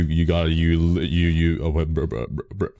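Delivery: usual speaking voice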